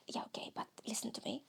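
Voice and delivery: quick, low whisper; hushed inner voice. Acted. Inner voice interrupting the host, insistent whisper. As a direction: whisper insistently to the other self.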